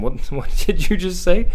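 mock shocked. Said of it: "she asked him".

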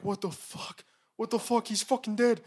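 high, frantic freak-out; panicked disbelief. Retold or imitated. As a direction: voice the drivers panicking. Retold drivers after hitting man.

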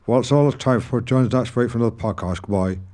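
exaggerated rural Norfolk accent; broad Norfolk accent. Shown as a demonstration. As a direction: sign off in thick Norfolk. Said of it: "in a broad Norfolk accent".

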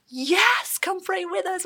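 high, loud and eager; excited yes. Retold or imitated. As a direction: burst out with joy at the invitation. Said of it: "We were so excited".

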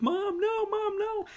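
squealed pleading, held back in volume; imitated screaming. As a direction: imitate a boy screaming for his mom. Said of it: "he's, like, screaming".

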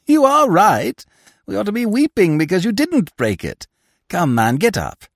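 high, loud, teasing retort; mocking Harlequin. Harlequin to fallen Scaramouche, loud mockery.

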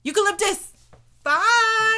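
loud, high joking goodbye; silly high sign-off. Pretend. Host signs off to listeners in a loud, high silly voice. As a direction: squeak it out as a goofy farewell.

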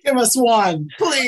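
high-pitched, joking beg; mock pleading. Mock plea as the candidate.

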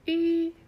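"う" held long and level as Mandarin ü; pronunciation drill. Shown as a demonstration. Teacher models today's sound for learners, long "う" as ü. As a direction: draw out "う" loud and high, lips rounded. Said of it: "今日の発音は".

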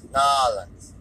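"New Orleans" squashed to "Nawlens" in mock accent; put-on accent swallowing the middle of "New Orleans"; Tommy Wiseau. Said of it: "the Tommy Wiseau accent".

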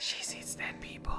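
whisper. Acted. Spooky quote joke.

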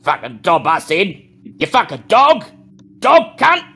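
a hoodlum screaming abuse at the whimpering man; thug yelling abuse. Acted. Thugs abusing man.

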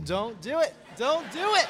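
exasperated plea. Real, not acted. Begging friends not to interrupt.

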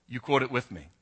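ordinary talk, nothing marked

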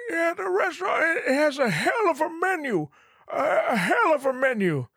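put-on high, thin old man voice, then a shout; hammed-up high old man shouting. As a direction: squeak it out like a frail old guy.

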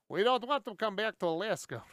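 ordinary talk, nothing marked

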